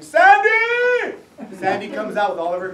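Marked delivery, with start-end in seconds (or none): 0.0-1.2 much higher, much louder call across the yard; father shouting for his daughter to come out; yelling a name. Retold or imitated. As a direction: holler for her from outside. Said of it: "he yells for Sandy".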